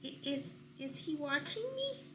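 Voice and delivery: soft, high stammering fear; frightened stammer. Acted. Scared rabbit asking if the stranger watches, soft stammer. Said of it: "scared rabbit".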